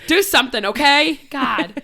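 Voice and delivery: loud, playful telling-off; mock scolding. Host jokingly bossing co-host around, mock scolding. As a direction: pretend to snap, half laughing. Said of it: "Just kidding".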